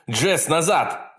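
high, slow yell. A narrator's reading. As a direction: bellow a warning. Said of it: "завопил он".

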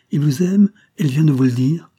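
loud, high, shaken questions; agitated exclamation. A narrator's reading. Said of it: "s'écria l'agent de police, non moins ému". (questions criées avec émotion)

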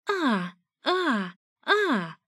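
three high acted moans; strained mock cries. A narrator's reading. Narrator competing with the lovers through the wall, high strained cries. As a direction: pitch up strained, repeated ahs. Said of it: "выкрикнула я надрывно".

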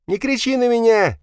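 very high, loud hysterical cry; hysterical outburst. A narrator's reading. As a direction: suddenly go hysterical, pitch shooting up. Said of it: "неожиданно заистерил Павел". (истеричный высокий крик)

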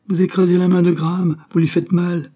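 alarmed cry. A narrator's reading. Daughter protesting as father crushes Graham's hand, alarmed cry.

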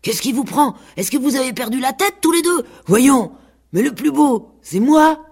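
high, worked-up pig breaking in; breathless pig. A narrator's reading. Pig gallops up to the squabbling rooster and goose, breathless. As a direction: burst in high, puffed and indignant. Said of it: "leur dit tout essoufflé".

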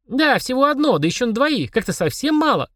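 put-on high little girl voice; girlish doubtful reckoning; pondering child. A narrator's reading. Girl weighing the demon's offer, high pondering voice. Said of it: "задумалась Полина".

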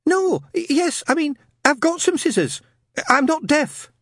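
very high, stammering lad; flustered young man. Acted. Newton flustered by Shadwell, high and stammering. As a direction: trip over the words.

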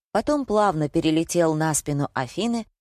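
ordinary delivery, nothing marked

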